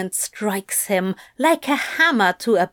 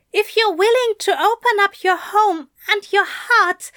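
emotional plea; 9 semitones higher, 3 dB louder than usual. A narrator's reading. Aziraphale's emotional declaration.

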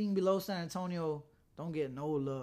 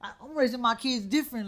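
higher, heated retold rant; mock angry scolding, pitched up; 7 semitones higher, 5 dB louder than usual. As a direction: lay down the law as an imitated fed-up dad. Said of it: "he was going off".

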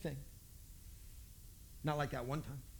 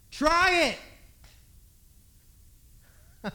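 much higher, louder command; the stranger insists they try it, much higher and louder; insistent shout; 8 semitones higher, 9 dB louder than usual. Retold or imitated. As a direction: push it hard.

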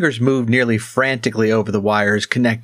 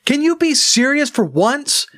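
stern rebuke. A narrator's reading. Crethin scolds the gnome for joking, stern and raised. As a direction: demand he take it seriously. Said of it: "sternly enough that Mirella put".

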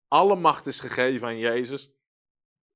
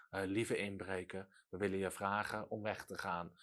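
much softer and lower, apologetic; meek timid plea; 6 semitones lower, 12 dB quieter than usual. Retold or imitated. Mocking a timid plea to a burglar, meek and soft. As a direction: ask the burglar politely and shyly. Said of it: "dat doe je ook niet bij een inbreker".

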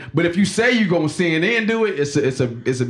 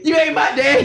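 put-on bratty kid shout; 11 semitones higher, 4 dB louder than usual. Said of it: "Jesus running up in Joseph's face like".

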